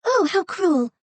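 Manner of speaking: put-on high girlish voice; a little girl crying out in dismay; girl exclaiming. A narrator's reading. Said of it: "the girl exclaimed".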